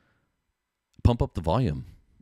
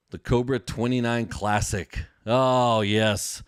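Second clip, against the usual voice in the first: delighted, louder "oh, yes"; excited; 4 semitones higher, 5 dB louder, much slower than usual. Real, not acted. A fan admiring the CB radio he just pulled out, excited. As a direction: savour the moment like finding treasure. Unboxing his old CB radio.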